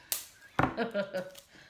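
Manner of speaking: short burst of chuckling; quick run of ha-ha laughter. Real, not acted. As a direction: laugh at your own joke. Jokes about an evil Grinch vlog.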